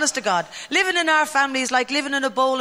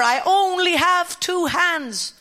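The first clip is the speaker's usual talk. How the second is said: slow, higher mock exasperation; exasperated mother; 6 semitones higher, much slower than usual. Mother to her kids, slow mock exasperation. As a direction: drag it out like a worn-out mum. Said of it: "you remember".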